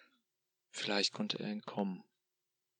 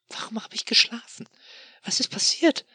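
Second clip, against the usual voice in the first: high, breathless whispered panic; sudden terror on waking; panicked; 9 dB louder than usual. Acted. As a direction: gasp the questions out in fright.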